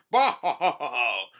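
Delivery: loud, hammed-up "ho ho" cackle; big theatrical belly laugh; villain laugh. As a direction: laugh boastfully like a pirate villain. Villain laughs at pirates' surprise.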